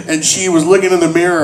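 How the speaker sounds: ordinary delivery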